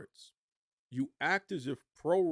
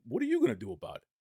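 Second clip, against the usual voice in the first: higher, fast, defiant retort; defiant comeback; 8 semitones higher, faster than usual. Retold or imitated. Host acting out Perry defying Punk, fast and defiant. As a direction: fire it back at a bullying coworker. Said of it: "it's not inappropriate to say".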